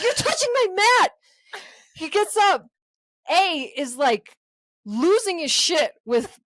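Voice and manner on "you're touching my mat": high, loud mock complaint; hammed-up outrage at a stranger; mock angry yell. Angry yoga man scolding a neighbour, mock yell. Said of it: "He's like".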